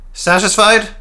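high, sharp needling question; high voiced Cecil. A narrator's reading. Cecil needling Peter, high and sharp. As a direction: jab at him in a high voice. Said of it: "asked Cecil".

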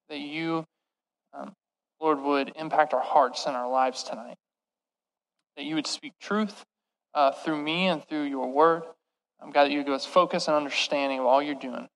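quiet, low opening prayer; hushed earnest prayer. Real, not acted. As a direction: pray softly before the message.

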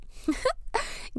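quick airy giggle; light breathy laugh. Real, not acted. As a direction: let out a small laugh. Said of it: "if you wanna laugh a little bit".

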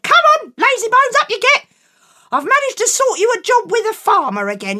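high, loud mother calling; loud bossy mum. Acted. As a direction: wake him up, bossy and loud. Mum waking Jack.

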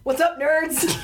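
high hyped host greeting; radio host greeting. Pretend. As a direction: greet the crowd like a DJ. Podcast intro greeting.

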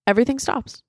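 ordinary talk, nothing marked

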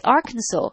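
Arkansas ending in "saw", silent final s. Shown as a demonstration. Quizzing an American host, Arkansas said as "AR-kan-saw". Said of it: "But it's Arkansas".

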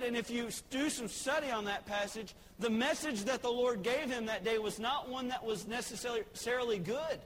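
ordinary delivery

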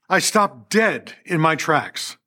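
normal talking voice

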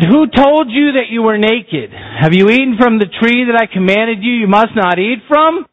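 God confronts Adam face to face; God confronting. Acted. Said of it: "Lord God said".

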